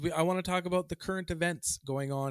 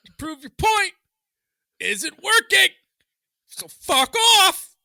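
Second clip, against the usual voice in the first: building from raised voice to high shout; slow, emphatic yelling that climbs higher; angry rant; 9 semitones higher, 10 dB louder than usual. Real, not acted.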